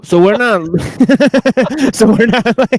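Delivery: high, loud laughter through words; squealing with laughter, barely speaking; laughing hard. Real, not acted. Cracking up at the remark.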